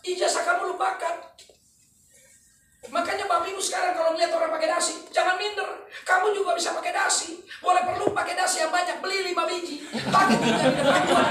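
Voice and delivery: loud, high, shouting seminar pitch; motivational preaching. Acted. Speaker rallying the seminar audience, shouting with passion. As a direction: fire up the crowd at full force.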